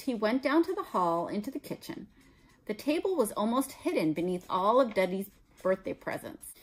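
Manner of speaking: ordinary delivery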